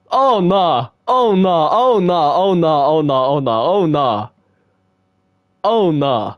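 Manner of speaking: freaked out. Real, not acted. Freaked out by the poem.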